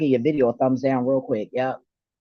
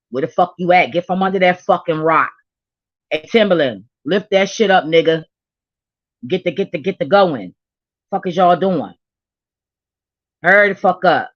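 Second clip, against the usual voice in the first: loud, punchy call-out; shouting at the artists to hurry up; 3 semitones higher, 7 dB louder than usual. Real, not acted. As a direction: demand they come back, loud. Calling out artists to return.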